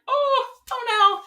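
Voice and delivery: very high, slow dismayed squeal; mock dismay. Viewer watching him realize the breakup, mock dismay. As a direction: draw it out high, playing dismay. Re-enacts dawning realization on show.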